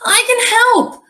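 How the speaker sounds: put-on high, loud little-boy shout; shouted extra loud. A narrator's reading. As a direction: shout it out so they hear you. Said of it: "Liam shouted extra loud".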